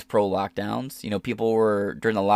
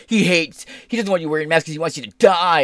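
higher, louder mocking accusation; mock accusing critics; 6 semitones higher, 6 dB louder than usual. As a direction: parody people ranting about him. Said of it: "'cause people be like".

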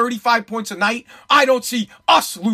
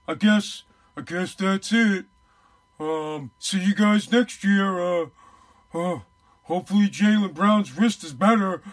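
lightly voiced, mopey, slow coach giving up; a hint of a deflated coach shrugging off the season; mock defeated coach; 2 semitones lower, slower than usual. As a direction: drag it out like a coach who has given up. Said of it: "coming out and saying".

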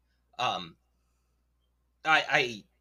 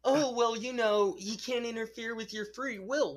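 mocking believer voice; 9 semitones higher than usual. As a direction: parody a smug apologist. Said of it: "mocking believers' argument".

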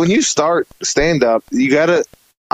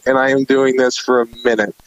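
ordinary talk, nothing marked

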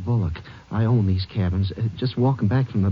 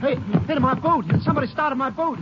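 much higher, urgent alarm; alarmed cry. Acted. Cabin owner sees his boat taken, alarmed higher cry. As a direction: blurt it out as the boat roars off.

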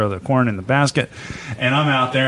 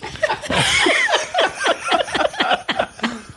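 long high real laugh; high helpless laugh; burst of high laughter. Real, not acted. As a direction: crack up at the memory. Laughing at own story.

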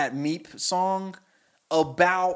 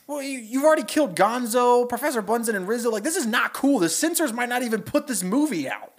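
panicked Kermit; 8 semitones higher than usual. Retold or imitated. Kermit on the windmill pleading with monster, high frantic Kermit. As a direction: plead with him in a frantic frog voice. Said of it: "Kermit goes".

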